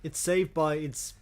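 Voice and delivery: ordinary delivery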